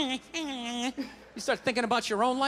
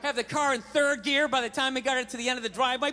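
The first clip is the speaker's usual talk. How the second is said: usual speaking voice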